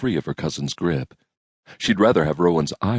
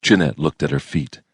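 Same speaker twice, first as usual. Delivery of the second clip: plain reading voice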